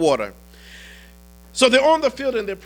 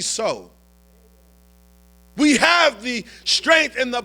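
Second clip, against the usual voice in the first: preaching at full force; 4 semitones higher than usual. Acted. A preacher rallying the congregation to conquer their burdens, rising and forceful. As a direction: build it to a triumphant climax. Sermon builds to climax.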